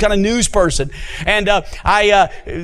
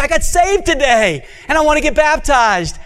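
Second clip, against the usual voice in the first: happy woman voice; 7 semitones higher than usual. Retold or imitated. Monica's news, voiced.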